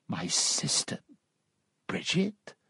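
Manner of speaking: hushed disbelief, rising at the end; soft, astonished question; stunned cry. A narrator's reading. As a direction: barely voice it, in shock. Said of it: "he cried".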